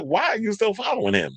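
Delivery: high-pitched mock disbelief; incredulous. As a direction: question them like they make no sense. Acted retort to the fans.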